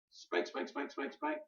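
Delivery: hushed, breathy spank spank; quiet playful spanking sounds; soft mock spank. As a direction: tap it out softly in play.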